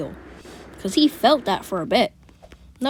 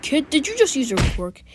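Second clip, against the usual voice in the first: shocked hero; 6 semitones higher, 4 dB louder than usual. Retold or imitated. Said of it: "be like".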